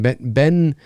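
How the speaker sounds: ordinary delivery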